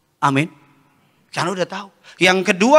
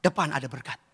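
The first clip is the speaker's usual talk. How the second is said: very low, hushed reassurance; God's calm reply; 9 semitones lower, 11 dB quieter than usual. Acted. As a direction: drop right down and soothe. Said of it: "Tuhan bilang apa?". (jawaban Tuhan yang tenang, rendah dan pelan)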